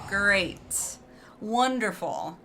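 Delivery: dry sarcasm, drawn out very slowly at the end; facetious fake enthusiasm; sarcastic mock excitement, stretching cannot wait. Real, not acted. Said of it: "me being facetious".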